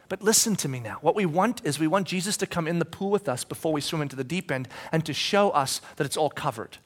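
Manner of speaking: ordinary delivery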